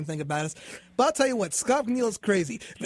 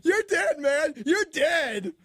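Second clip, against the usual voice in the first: mock crying; 9 semitones higher, slower than usual. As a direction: wail it like fake crying. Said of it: "Scott starts acting like he's crying".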